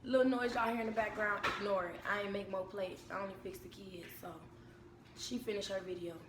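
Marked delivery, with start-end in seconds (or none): none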